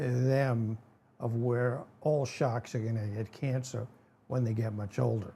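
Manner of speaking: ordinary talk, nothing marked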